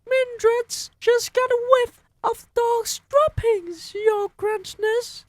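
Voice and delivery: very high, loud witch call; calling back. Acted. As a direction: call up to the platform. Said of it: "someone called back at her".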